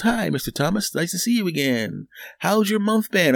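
high, sweet teller greeting; bank teller voice. Retold or imitated. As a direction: play a chirpy bank clerk. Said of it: "the lady behind the counter goes".

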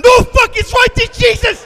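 very high, very loud panic; panicked scream. Acted. Passengers seeing the earth right there, panicked scream. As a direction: shriek in terror as disaster appears.

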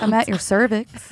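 sultry voice. Pretend. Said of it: "You sound so innocent".

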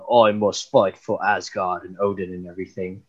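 low, put-on heroic voice; deep, solemn warrior declaration; Chris Hemsworth. Mocking an overly serious Thor, deep Hemsworth impression. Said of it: "actually a pretty good Chris Hemsworth impression".

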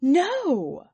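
loud, high, swooping no; a whole household's emphatic no; chorused denial. A narrator's reading. They all answered Sir again, loud and emphatic. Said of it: "they all answered again".

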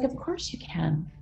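soft, slow, kind reassurance; gentle reassurance. Retold or imitated. A woman softly reassuring her friend, gentle and slow. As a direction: say it tenderly, giving permission. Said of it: "she's so loving".

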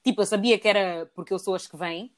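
ordinary talk, nothing marked

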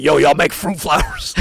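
high, loud mock holler; re-enacted boisterous shout; loud incredulous query. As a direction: call across the shop in comic amazement. Acted loud question to shop staff.

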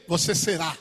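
normal talking voice

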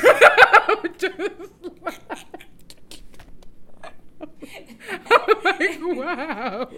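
high breathless laughing; helpless laughter. Real, not acted. Seeing an insult before going on stage, helpless laughter. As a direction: lose it laughing.

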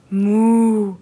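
long, loud, drawn-out moo; mooing cow. A narrator's reading. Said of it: "brummte eine Kuh". (langgezogenes, lautes Muhen einer Kuh)

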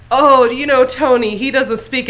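a loose impression of Tramp brushing it off; Tramp's voice. Tramp explaining Tony to Lady, lightly imitated. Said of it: "Tramp is like".

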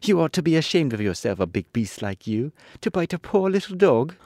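put-on a child telling off a big beast; little girl scolding the lion; angry scolding. A narrator's reading. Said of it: "who was still angry".

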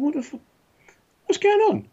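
high, rising to a loud yell of disbelief; mock alarmed shout; shocked outburst. Shocked on seeing kids playing.

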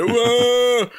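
loud, high, long "whoo"; drawn-out mimicked crowd roar; imitated crowd scream. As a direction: holler like a rowdy drunk audience. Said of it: "och skriker".

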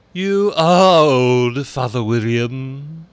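very high, loud, slow caterpillar. A narrator's reading. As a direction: stretch it out grandly. Caterpillar voiced in story.

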